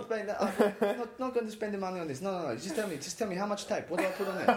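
put-on old Greek man. Old Greek man brushing off the plumber, hammed-up. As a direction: wave it off like a stubborn old man. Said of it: "silly old Greek men just going like".

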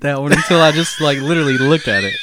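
laughing while talking; giggling through the words. Real, not acted. Laughing fit during joke contest.

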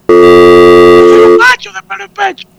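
a comic host plays a hairy-chested macho, booming; mock macho voice. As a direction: puff up like a he-man. Bragging macho pose.

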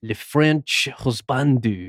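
slow, drawn-out mock French accent. Shown as a demonstration. Asked by a friend to read the username in a French accent. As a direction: lay on a French accent for the name. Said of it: "You gotta do the accent".

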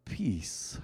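spoken blessing, swelling to full force; benediction. Real, not acted. Pastor blesses the congregation at close, forceful benediction.

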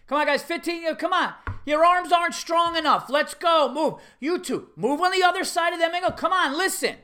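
high, loud coach barking; exasperated coach. Retold or imitated. Coach moving kids around at practice, exasperated hollering. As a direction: holler impatient commands at the kids. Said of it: "So he's gone".

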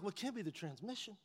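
hushed urging to the truck; coaxing whisper to truck; quiet coaxing. Retold or imitated. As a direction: softly cheer the truck on. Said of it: "I'm like".

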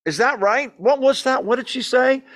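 high, loud calling out; wife yelling questions over to her husband; yelling across church. Acted. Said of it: "is yelling".

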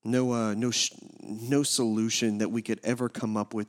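low, hushed congregational prayer. Real, not acted. Pastor leading the congregation before the message, low quiet prayer. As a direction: pray softly and reverently, pitched low.

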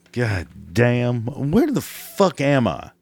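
ordinary talk, nothing marked

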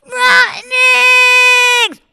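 loud high mischievous yell. Acted. Jackie yells at the old woman's door, loud high yell. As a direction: holler it like a naughty boy. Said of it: "he'd yell out".

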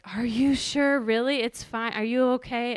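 insistent worried questioning, re-enacted; retold worried disbelief. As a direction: imitate an anxious husband pressing for reassurance. Worried husband on the phone, retold.

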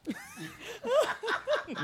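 bursts out in a high laugh; real laugh at the teasing. Real, not acted. (risa alta y espontánea)

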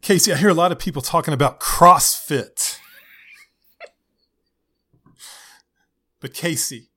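lightly voiced preachy youth pastor; hint of an earnest youth leader; upbeat youth pastor. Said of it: "the random youth pastor voice".

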